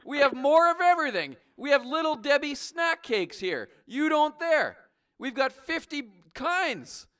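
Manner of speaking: loud, high ranting brag; mock angry rant. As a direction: yell the brag at the caller. Trucker rants at Canadian caller.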